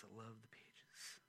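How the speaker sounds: soft whispered repeat of I love the pages; a fond whisper to the book. Shown as a demonstration. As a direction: whisper it tenderly to the Bible. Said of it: "repeats it in a whisper".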